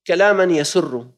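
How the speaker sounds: ordinary delivery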